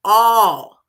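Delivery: "All" called out loud and alone; stress on ALL. Shown as a demonstration. Preacher leads listeners to say it along, emphatic "All". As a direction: belt "All" for others to join. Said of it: "say it with me".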